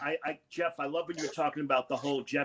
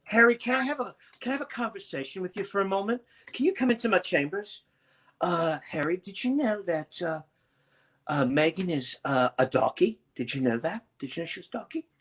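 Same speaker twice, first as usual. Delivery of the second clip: the Queen. Retold or imitated. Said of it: "see those conversations where she'd go".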